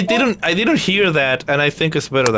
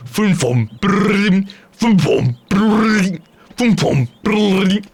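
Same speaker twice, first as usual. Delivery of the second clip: sword sound effects; 3 semitones higher, much slower than usual. Shown as a demonstration. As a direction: mouth the swords swinging and ringing. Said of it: "those swords do make this sound".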